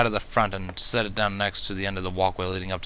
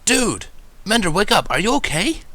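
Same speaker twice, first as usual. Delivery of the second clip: put-on urgent young dragon; 12 semitones higher, 5 dB louder than usual. A narrator's reading. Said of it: "in a quiet but sturdy male voice".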